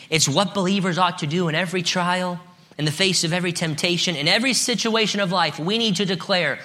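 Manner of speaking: high, driving sermon delivery; impassioned preaching. Acted. Preaches with raised voice.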